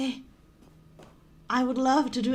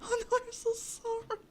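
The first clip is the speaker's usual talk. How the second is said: high, whimpered apology; crying; 6 semitones higher than usual. Real, not acted. Player sorry as the narrator goes back, crying. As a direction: keep apologizing in a tearful whimper. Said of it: "I'm about to cry".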